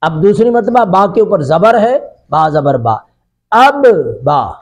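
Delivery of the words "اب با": اب با drawn out slowly, syllable by syllable; teacher slowly sounds out اب با for pupils; slow blending. Shown as a demonstration. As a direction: stretch اب then با so the doubled ب is heard. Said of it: "اس کو اکٹھا پڑھیں گے".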